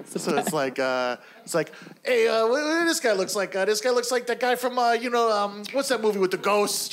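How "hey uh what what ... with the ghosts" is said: higher-pitched fumbling comic voice; hammy comic groping for a reference; forgetful crowd work comic. Acted. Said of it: "developed a character that's a crowd work comic".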